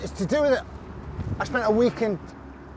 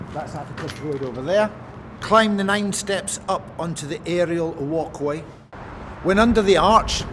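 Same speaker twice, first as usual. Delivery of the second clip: formal reading aloud; 3 semitones higher than usual. Acted. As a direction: read each instruction out like a proclamation. Reads score aloud on location.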